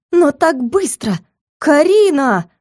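high, drawn-out dismay; scandalized high exclamation; shocked protest. Acted. Protests at the sudden engagement.

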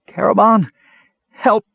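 high, loud, urgent plea; cry for help. A narrator's reading. Trapped kender begging his friend for help, high urgent cry. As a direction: call out high as if about to be cut off. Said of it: "came a muffled voice".

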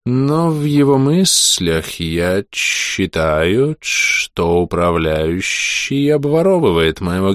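slow eerie hissing mage; strange whistling voice. Acted. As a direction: draw out each word, sinister and sibilant. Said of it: "странным свистящим голосом".

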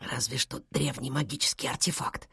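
very soft, hushed confidence; low-volume conspiratorial aside; whispered aside. A narrator's reading. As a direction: whisper it so granny won't hear. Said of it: "взглянул на Сахли и прошептал".

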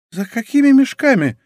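high, incredulous question; astonished query; bewildered. Acted. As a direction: ask it high-pitched, utterly baffled. Baffled question about the sacks.

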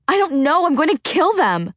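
high, loud, tearful rage; furious. A narrator's reading. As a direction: spit it out through tears. Freya furious after the disaster.

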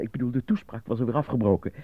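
normal talking voice

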